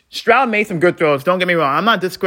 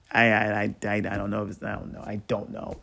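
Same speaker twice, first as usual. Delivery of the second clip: dejected mumble; 8 semitones lower, 10 dB quieter, faster than usual. Real, not acted. Stunned, defeated after the loss.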